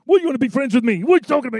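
loud, high imitated outburst; really mad. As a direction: act out an angry man challenging would-be friends. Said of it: "he's really mad at them".

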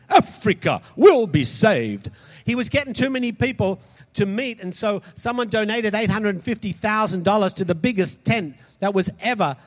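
ordinary talk

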